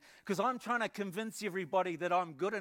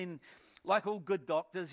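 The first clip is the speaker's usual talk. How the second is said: normal talking voice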